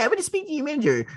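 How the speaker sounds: high, fast entitled Karen. Retold or imitated. Fans do the Karen voice at the host, high and fast. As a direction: whine like a customer demanding the manager. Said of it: "doing the Karen voice".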